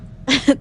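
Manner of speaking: quick burst of laughter; a brief giggle; short laugh. Real, not acted. Laughing mid-read.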